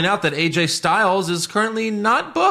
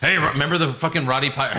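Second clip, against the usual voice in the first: ordinary delivery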